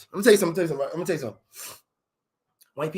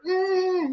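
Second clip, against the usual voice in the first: high mock muffled squeal; muffled captive cry; 12 semitones higher than usual. Captive hearing the kidnappers talk, high mock muffled cry. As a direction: squeal through closed lips. Acted captive in back of car.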